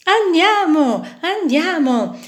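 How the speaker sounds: high, loud and eager; eager agreement. Acted. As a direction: jump at the idea of ice cream. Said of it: "dissero pesci".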